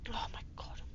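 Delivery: soft unvoiced whisper. Real, not acted. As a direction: whisper it in dismay. Whispered disbelief.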